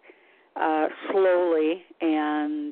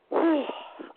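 heavy sigh; 10 semitones higher than usual. Real, not acted. Pauses before telling her hard path.

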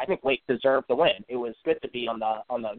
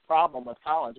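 ordinary talk, nothing marked

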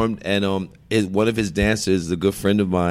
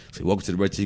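ordinary talk

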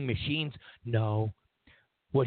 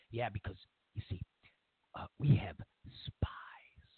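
hushed mock revelation; 8 semitones lower, 8 dB quieter than usual. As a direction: whisper the last word like a secret. Mock confidant reveals a secret.

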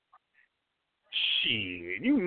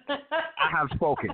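slow, lofty decree; grand decree; 7 semitones higher, slower than usual. Acted. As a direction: proclaim it with finality. Ends the decree.

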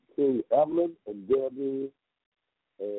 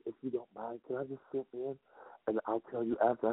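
soft mother; 8 dB quieter than usual. Retold or imitated. Mother quietly asks to sit in.

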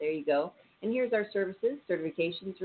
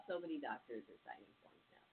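quiet aside; 3 semitones lower, 18 dB quieter than usual. Real, not acted.

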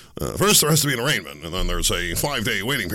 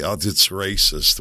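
ordinary talk, nothing marked

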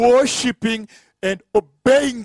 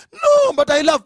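acted protest; 7 semitones higher than usual. Abraham told to slaughter his son, acted protest. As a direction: plead against the order, pitched up. Abraham objects to God's order.

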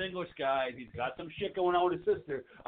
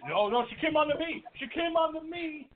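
high, pleading excuse voice; hammed-up panicked denial; mock whiny Thomas; 11 semitones higher than usual. Thomas voiced defending himself.

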